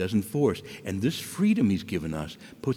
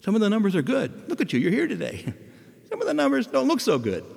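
higher, lightly voiced Jesus, gently blunt with a laugh; Jesus speaking frankly; 10 semitones higher than usual. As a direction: play Jesus giving honest news. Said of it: "And so Jesus is saying".

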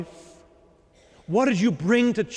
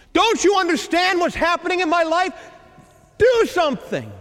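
higher, louder, demanding complaint to God; frustrated plea; 10 semitones higher, 3 dB louder than usual. Pretend. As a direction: plead impatiently, almost scolding.

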